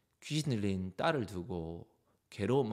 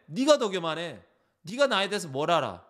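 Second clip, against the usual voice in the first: raised, indignant counterattack; angry retort; 6 semitones higher, 4 dB louder than usual. Acted. Proud person lashing back at the accuser, angrily. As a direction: turn the accusation back on them, heated. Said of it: "반문을 하고 화를 내고".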